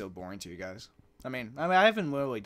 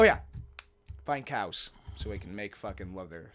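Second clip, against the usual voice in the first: ordinary talk, nothing marked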